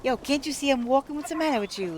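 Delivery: annoyed New Yorker, quick; hammed-up New York street gripe; mock New York gripe. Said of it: "we usually say something like".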